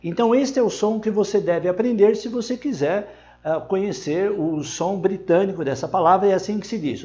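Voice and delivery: ordinary talk, nothing marked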